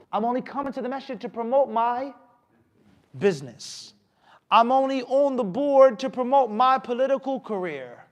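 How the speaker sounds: lightly mocked careerist, pitched high; self-serving board member. Preacher mimicking self-serving board members, mock self-promotion.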